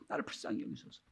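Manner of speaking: a bare whisper of mercy; humble prayer. Retold or imitated. The tax collector prays humbly to God, whispered. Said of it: "아무런 조건이 없어요".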